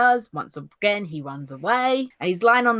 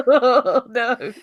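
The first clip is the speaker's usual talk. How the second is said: laughing dismay; 6 semitones higher, 7 dB louder than usual. Retold or imitated. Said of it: "She's like".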